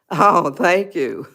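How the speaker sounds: normal talking voice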